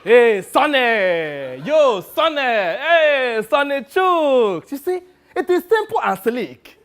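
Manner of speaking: drawn-out high, loud hailing; Sonny, showing off. Acted. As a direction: call out the name like greeting a star. Demonstrates how people hail him.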